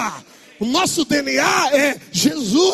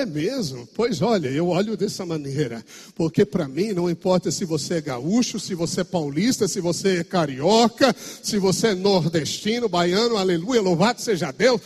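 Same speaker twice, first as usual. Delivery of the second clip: polite gentle reply; 8 semitones lower, 3 dB quieter than usual. Retold or imitated. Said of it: "E ainda eu sou delicado, polido".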